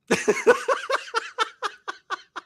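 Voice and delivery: high, long burst of laughter. Real, not acted. Laughing at his own punchline, high burst of laughter. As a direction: crack up at the punchline.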